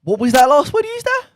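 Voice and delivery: hammed-up high, loud disbelief; playful outraged shout; mock indignation. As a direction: act scandalised by the answer, shouting it back. Mock outrage over Midlands answer.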